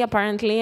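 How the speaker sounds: normal talking voice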